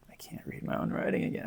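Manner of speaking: real whisper to herself, a bit fast; whispered aside. Real, not acted. Squinting at her own notes, whispered aside. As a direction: breathe it under your breath.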